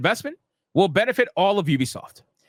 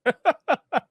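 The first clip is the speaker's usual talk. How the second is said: laughing; 6 semitones higher than usual. Real, not acted. Laughs at own joke.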